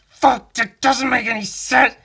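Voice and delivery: loud, high-pitched retold rant; exasperated outburst. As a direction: explode in comic frustration at the nonsense. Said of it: "I was like".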